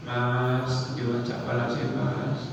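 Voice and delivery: soft, low beggar's plea; pleading beggar. Acted. Beggar asking a passer-by for pity, softly pleading.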